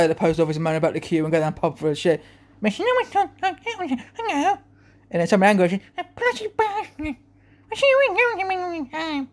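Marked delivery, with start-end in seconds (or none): 2.6-4.6 very high, slow, slurred character voice; cleft palate impression. Lady with a cleft palate speaking, high muffled impression. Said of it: "cleft palate". 5.7-9.3 cleft palate impression. Lady moaning about some man, high cleft-palate impression. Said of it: "cleft palate".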